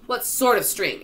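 high, loud, sharp demand; sharp question. A narrator's reading. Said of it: "asked the old green grasshopper sharply".

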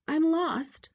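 high princess voice; pleading princess. A narrator's reading. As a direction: appeal to the witch for help. Princess appeals to witch.

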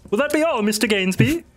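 high, deferential servant; Rahir. Acted. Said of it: "Rahir gives a little bow".

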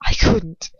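choked, breaking whisper; spitting out the words in desperation; sputtering desperately. Acted. Harry struggling to get words out to Lucius, sputtering. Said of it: "He just sputters and spits desperately".

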